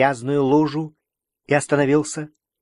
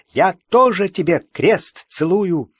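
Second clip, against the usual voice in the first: eager; 6 semitones higher, 4 dB louder than usual. A narrator's reading. Young Golitsyn swears eagerly.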